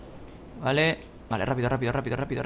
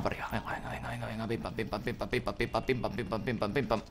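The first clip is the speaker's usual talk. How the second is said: whispered urging; 5 semitones lower, 10 dB quieter than usual. Real, not acted. Urging on kart in game.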